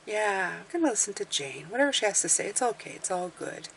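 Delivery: softly voiced easygoing listener; relaxed listener. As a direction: settle back and say it quietly. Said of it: "sit back, relax, have a coffee".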